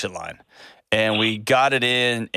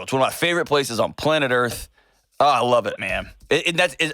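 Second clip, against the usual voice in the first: usual speaking voice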